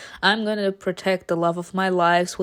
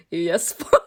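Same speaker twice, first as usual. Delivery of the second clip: bored obedience; 4 semitones lower than usual. Acted. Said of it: "literally is bored to death".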